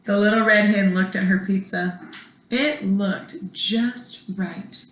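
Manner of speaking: plain reading voice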